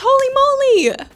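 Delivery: high, loud exclamation of wonder; squealed holy moly; mock awe. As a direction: ham up being impressed. Hammed-up reaction to car payments.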